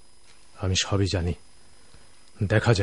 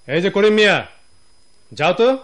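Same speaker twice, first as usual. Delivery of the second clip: calling out; 10 semitones higher, 7 dB louder than usual. Acted. Boss calling out to servant.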